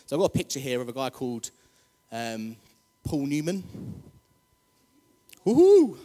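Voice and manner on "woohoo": very high, loud woohoo; excited cheer. Real, not acted. Preacher cheering Paul Newman's picture, very loud. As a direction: whoop with delight.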